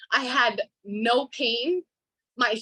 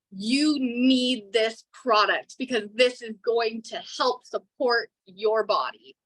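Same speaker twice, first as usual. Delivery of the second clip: ordinary talk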